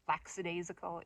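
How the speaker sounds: "lackadaisical" as lax-a-daisical; "lackadaisical" beginning like "lax"; mistaken pronunciation. Shown as a demonstration. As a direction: slip an S into "lackadaisical". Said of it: "I thought there was an S there".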